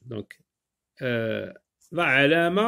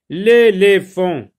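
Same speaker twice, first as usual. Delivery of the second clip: loud, slow, higher announced word; dictation style; 4 semitones higher, 10 dB louder, slower than usual. Shown as a demonstration. Teacher announcing a new word to pupils, loud dictation style. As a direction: call out the new word, drawn out.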